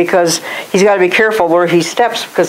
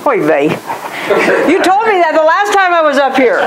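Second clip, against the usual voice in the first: put-on high, exasperated Yiddish accent; 10 semitones higher than usual. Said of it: "And Moses is going".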